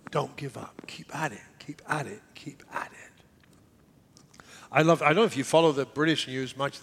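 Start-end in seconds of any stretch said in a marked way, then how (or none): 0.1-4.4 soft fading to slow whisper. Real, not acted. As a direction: let it fade into a whisper.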